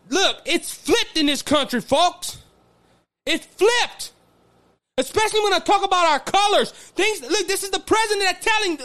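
high-pitched, exasperated outburst; angry. Real, not acted. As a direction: raise the pitch in real indignation. Angry rant about the country.

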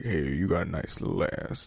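low, hushed creepy cartoon voice; sleazy mock cartoon voice, quiet; mock creepy character. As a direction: drop it down soft and leering.

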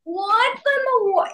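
real shock at a forgotten memory; stunned disbelief. Real, not acted. Amazed by old interview memory.

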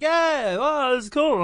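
high, pleased drawn-out reply; drunk confidence. Retold or imitated. Telling how he answered fans while drunk, tipsily pleased. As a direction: play back your tipsy, pleased self. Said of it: "I'm drunk and I'm on a good date".

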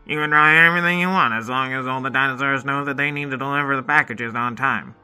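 brisk, bossy pterodactyl; fast no-nonsense boss. Acted.

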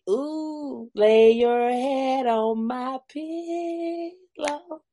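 slow, crooning come-on; close warm intimate boyfriend.

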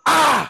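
a sudden high cry of release at the end of a dance move; retold scream. Dancer finishing the audition move, high short scream. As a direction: let out a short high shout. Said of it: "I just screamed".

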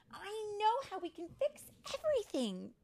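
high, loud eager fairy voice; bursting with a bright idea; excited cry. A narrator's reading. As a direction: announce the solution to everyone. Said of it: "Tink cried, flying up".

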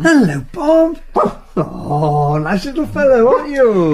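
high-pitched pet-greeting voice; fussing over a dog. Acted. Visitor greeting the housekeeper's dog, high fond fussing. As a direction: coo at a friendly dog.

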